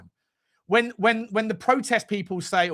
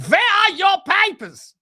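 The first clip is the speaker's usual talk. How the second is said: mock German officer, much higher, louder and slow; German accent; 14 semitones higher, 8 dB louder, slower than usual. Joking that a viewer named Schulberg is German, in a hammed-up German accent. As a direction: bark it like a cartoon German guard. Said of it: "you probably got some Germanic".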